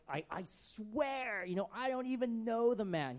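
high, drawn-out insistent vow; hammed-up protesting oath; swearing an oath. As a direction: swear it emphatically, stretching the words. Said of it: "this time with an oath".

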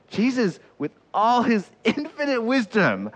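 high, stretched-out build-up; grand proclamation. Real, not acted. Preacher magnifying Jesus before a humble line, grand proclamation.

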